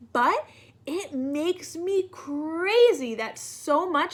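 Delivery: fussy crayon building to a loud, near-tearful outburst; a neat crayon losing patience; exasperated purple crayon. Acted. Reads Purple Crayon's letter.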